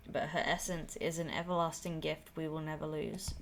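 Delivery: usual speaking voice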